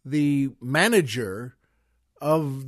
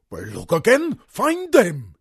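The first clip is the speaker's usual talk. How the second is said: put-on German-accented boss, bursting louder on the order; Sig Rumen accent; 2 semitones higher, 5 dB louder than usual. Station head ordering staff in a German accent. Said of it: "sounded like Sig Rumen".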